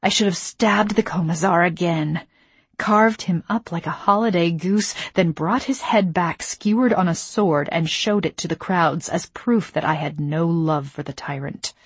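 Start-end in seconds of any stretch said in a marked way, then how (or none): none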